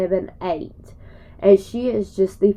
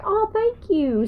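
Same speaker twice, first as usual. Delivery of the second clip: much higher, slow sweet thanks; mock high thanks; 13 semitones higher than usual. Thanking her friend, much higher and slower. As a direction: gush it in a high voice. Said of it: "I'm like".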